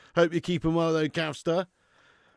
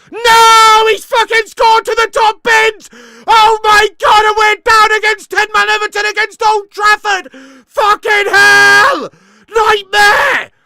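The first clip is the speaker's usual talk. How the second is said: screaming, much higher and louder; furious shout; 16 dB louder than usual. Real, not acted. Fan watching rival score, screaming in despair. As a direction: roar in real despair at the goal.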